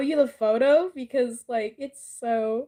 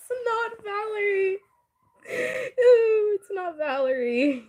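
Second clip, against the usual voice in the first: laughing denial; 6 semitones higher than usual. Real, not acted. Telling Zenny the guess is wrong, laughing helplessly. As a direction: try to deny it through real laughter. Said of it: "I know I'm la-, laughing".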